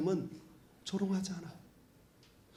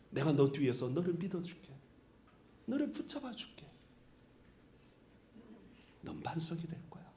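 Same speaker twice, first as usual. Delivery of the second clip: soft near-whisper, much softer; tender whisper; 4 semitones lower, 12 dB quieter than usual. Retold or imitated. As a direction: hush it gently like a promise. God's tender words to Peter. (부드럽게 속삭이며 약속하는 하나님)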